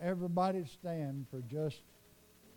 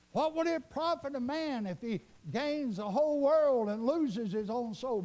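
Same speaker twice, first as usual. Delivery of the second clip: raised, higher preacher's cry; preaching; 7 semitones higher, 4 dB louder than usual. Real, not acted. Preacher quoting a warning to the congregation, preaching. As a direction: declaim scripture to the congregation. Preacher quotes scripture with force.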